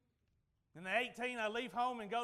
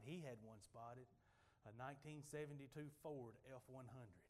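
usual speaking voice

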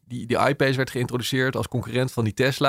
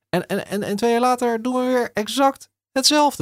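much higher, outraged disbelief; incredulous; 12 semitones higher than usual. Real, not acted. Commentator mocking repeated subsidy policy, incredulous high voice. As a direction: let the absurdity ring out high.

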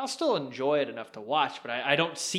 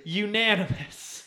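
excited announcement; 6 semitones higher, slower than usual. Real, not acted. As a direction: savor the word like a big announcement. Vote result repeated.

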